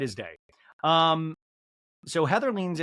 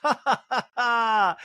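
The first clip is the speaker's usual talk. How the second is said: imitated laugh; 7 semitones higher, 3 dB louder, slower than usual. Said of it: "And Angie K laughs".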